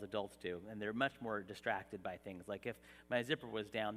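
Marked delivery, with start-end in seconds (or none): none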